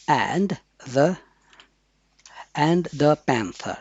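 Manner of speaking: slow "and the panther", each word set apart; drawn-out "and the panther". Shown as a demonstration. Teacher reads slowly so students catch each sound. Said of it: "आप देखिएगा यहाँ पर है and".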